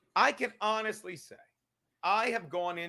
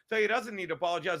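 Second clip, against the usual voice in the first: usual speaking voice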